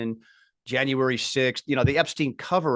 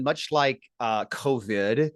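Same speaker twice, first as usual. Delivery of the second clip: ordinary delivery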